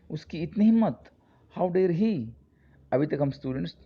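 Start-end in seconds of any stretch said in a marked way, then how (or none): 0.1-2.3 higher, louder outraged exclamation; indignant. Shown as a demonstration. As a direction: demo of shocked anger. Teacher shows outrage exclamation. (गुस्से में ऊँची आवाज़ से)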